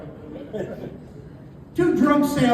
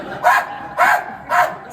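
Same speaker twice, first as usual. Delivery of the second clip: repeated very high panicked shrieks; hammed-up shrieks, as if being pecked; mock screaming; much slower than usual. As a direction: scream in short comic bursts. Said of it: "And I'm screaming".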